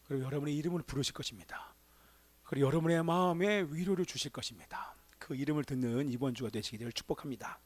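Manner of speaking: whispered, hushed blessing. Real, not acted. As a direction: whisper the blessing over them. (속삭이는 축복)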